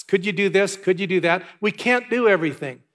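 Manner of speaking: ordinary talk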